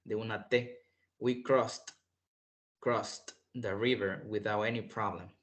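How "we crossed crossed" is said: 'crossed' ending in a T, krost; final T sound. Shown as a demonstration. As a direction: say 'crossed' with -ed as a plain T. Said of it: "con sonido de T". ('crossed' pronunciado con sonido de T al final)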